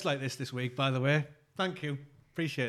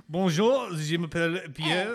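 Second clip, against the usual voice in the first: mock French accent; 3 semitones higher, 6 dB louder, slower than usual. Host joining the accent game, in hammed-up French. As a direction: introduce yourself in exaggerated French.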